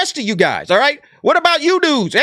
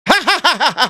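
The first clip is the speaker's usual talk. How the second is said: taunting fake laugh, the first ha pitched up; mocking laugh; 4 semitones higher, faster than usual. Mocking fake laugh at the striking workers. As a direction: laugh at them like a schoolyard bully. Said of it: "now who's laughing".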